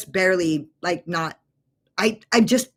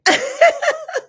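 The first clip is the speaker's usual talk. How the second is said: high, loud sudden laugh; a real startled laugh; high burst of laughter; 4 dB louder than usual. Real, not acted. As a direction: let out a sharp giggle. Friend laughs after confessing.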